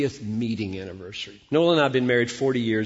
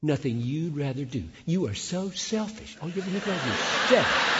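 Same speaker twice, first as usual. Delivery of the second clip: mock indignant wife; 3 semitones higher than usual. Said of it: "would she ever say".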